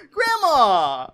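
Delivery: loud, high playful protest; mock-scandalized shout. Grandchild calling out grandma in Congress, mock-scandalized shout. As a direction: call out in mock dismay.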